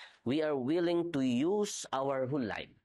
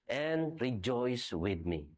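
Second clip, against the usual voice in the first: slow invitation; much slower than usual. Retold or imitated. Master invites servant to rejoice.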